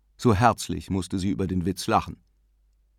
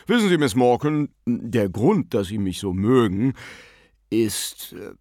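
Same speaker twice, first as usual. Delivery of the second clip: put-on gentleman's voice, loud opening then faltering; ladies' man; 4 dB louder than usual. A narrator's reading. Bearded guest confides in hostess about his charm, smug. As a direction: confide smugly, then trail into hesitation. Same gentleman flattering himself, breaks off.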